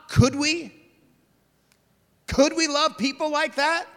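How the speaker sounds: high, tentative questioning; mock wondering aloud; doubting question. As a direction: lift the pitch as if unsure it can be done. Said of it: "it's not even a question for John".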